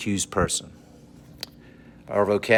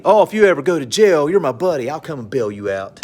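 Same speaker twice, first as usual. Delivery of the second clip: mock buddy; 4 semitones higher, 4 dB louder than usual. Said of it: "A lot of people think it's funny".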